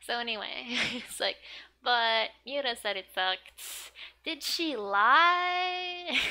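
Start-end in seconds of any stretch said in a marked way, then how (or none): 1.8-6.2 put-on high, slow childish "Did she lie?" stretched out; playful kid voice reading manga lines; Yotsuba's voice. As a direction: read it as the little girl, drawn out and high. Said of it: "That's totally what Yotsuba would say".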